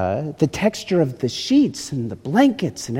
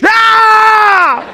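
sharp shrill yelp of pain, high and loud; patient at the chiropractor, yelping in pain; 7 dB louder than usual. Acted. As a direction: yelp as the back cracks. Chiropractor cracks back, yell.

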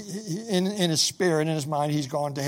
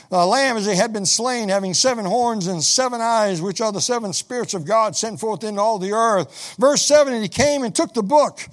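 higher, louder preaching read; preached scripture reading; 4 semitones higher, 5 dB louder than usual. Acted. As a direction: proclaim the verse to the congregation. Reads scripture aloud.

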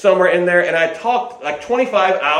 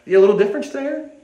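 ordinary talk, nothing marked

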